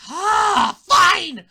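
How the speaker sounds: loud exasperated giving in; fed-up parent. Retold or imitated. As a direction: give in with a furious groan. Parent gives in angrily.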